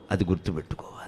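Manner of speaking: hushed whisper of advice; whispered aside. Real, not acted. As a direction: lean in and whisper the reminder. Confiding aside to audience.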